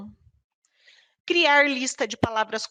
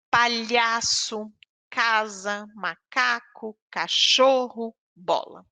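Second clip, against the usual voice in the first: slow dictation; slower than usual. Shown as a demonstration. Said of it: "dictating words slowly".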